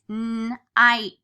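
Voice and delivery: teacher models hearing the t in night; sounding out night. Shown as a demonstration. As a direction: stretch night so the t at the end is heard. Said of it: "if I hear a T at the end".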